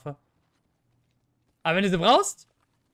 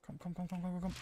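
quiet urging; 13 dB quieter, faster than usual. Real, not acted. Tense urging under breath.

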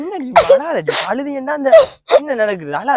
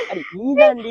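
tearful plea; 9 semitones higher, 4 dB quieter than usual. Acted. Pleading lover in comic skit.

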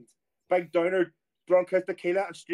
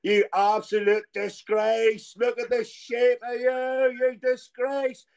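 slow, raised mock telling-off, higher; imitating an angry manager; 6 semitones higher, much slower than usual. Retelling the manager scolding a drunk player, mock outrage. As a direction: drag out a furious scolding in jest. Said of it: "I heard Harry obviously going".

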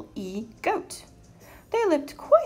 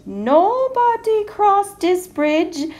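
big, slow, booming troll roar; troll's roar; 8 dB louder, slower than usual. A narrator's reading. Mean troll warning anyone crossing his bridge, roaring slowly. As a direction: roar it out loud, stretching the words. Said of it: "he would roar".

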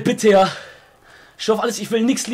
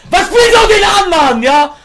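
high, loud exasperated yell; angry outburst; 8 semitones higher, 6 dB louder than usual. Real, not acted. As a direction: yell at a teammate over a bad pass. Angry at a bad pass in game.

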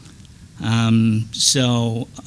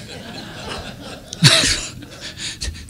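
wheezing laugh; 4 dB quieter than usual. Real, not acted. After the miming, cracking up.

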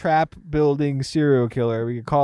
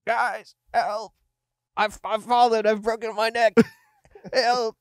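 mock calling for help; 8 semitones higher, slower than usual. Brian calling from the trap.

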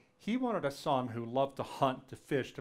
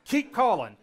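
urging call; 10 semitones higher, 11 dB louder than usual. Retold or imitated. Child urging dad.

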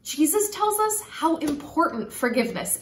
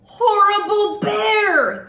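loud, slow, higher angry yell; angry shout; 6 semitones higher, 6 dB louder, slower than usual. Acted. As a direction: shout it at the bear, stomping mad. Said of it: "the girl shouted".

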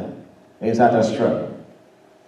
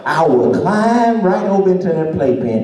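loud, high, soaring sermon climax; full-force preaching; 8 semitones higher, 5 dB louder than usual. Acted. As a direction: shout God's promise to the congregation.

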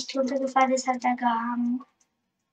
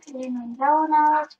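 ordinary talk

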